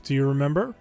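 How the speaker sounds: ordinary talk, nothing marked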